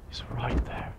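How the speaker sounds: scared whisper; hushed, afraid of being heard. Real, not acted. Hiding from monster, whispering.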